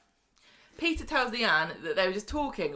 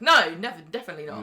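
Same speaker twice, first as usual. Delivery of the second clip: emphatic loud denial; 9 dB louder, faster than usual. Retold or imitated. Quoting Leanne.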